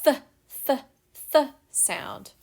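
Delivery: repeated "th" with tongue between teeth; th sound demo. Shown as a demonstration. Teacher to class, sounding out th. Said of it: "they make the".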